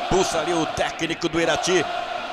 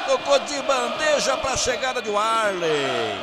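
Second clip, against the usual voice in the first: goal call; 3 semitones higher than usual. Real, not acted. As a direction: explode on the goal. Third goal called.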